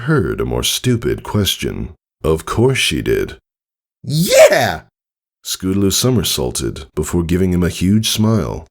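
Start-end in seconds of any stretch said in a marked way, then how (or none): 4.0-4.8 high, loud excited yes; eager yell. Acted. Scootaloo answering Tycho, thrilled. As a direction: shout it with joy.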